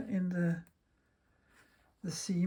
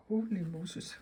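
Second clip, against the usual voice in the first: normal talking voice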